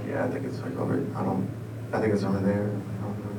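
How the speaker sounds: low, very quiet halting reply; hesitant mumble. Retold or imitated. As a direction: murmur it unsurely, trailing into near silence. Acted reply to shopper, unsure.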